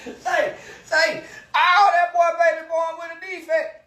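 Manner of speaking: taunting shout. Real, not acted.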